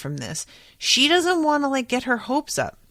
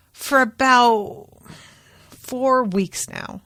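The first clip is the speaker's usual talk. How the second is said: slow deadpan; 4 semitones higher, slower than usual. Retold or imitated. Ethan's deadpan joke reply.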